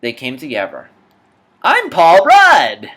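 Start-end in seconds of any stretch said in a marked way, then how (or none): 1.6-2.9 higher, much louder slow proclamation; excited shout. Acted. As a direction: announce it proudly. Announcing himself as Paul Rudd.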